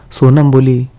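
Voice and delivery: usual speaking voice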